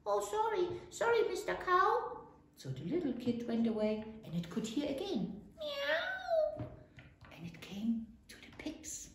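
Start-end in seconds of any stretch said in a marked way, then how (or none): 0.1-2.2 high, stammered apology; apologetic little kid goat. A narrator's reading. 5.6-6.7 very high little meow; cat's meow. A narrator's reading. Cat call heard again.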